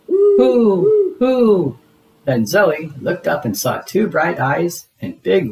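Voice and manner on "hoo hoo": high, hooting owl call; spooky owl hooting. Acted. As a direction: hoot it out like an owl in the night.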